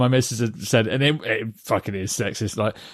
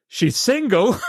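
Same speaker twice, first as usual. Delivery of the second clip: higher, laughing as he quotes the host; host quote through laughter; 9 semitones higher, slower than usual. Retold or imitated. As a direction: chuckle out the host's line. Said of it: "And then he goes".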